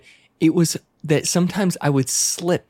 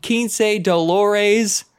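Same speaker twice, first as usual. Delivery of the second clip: put-on very slow, higher gringo Spanish with an American accent; 6 semitones higher, slower than usual. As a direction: drag out the price like a clueless Texan. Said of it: "I'll be like".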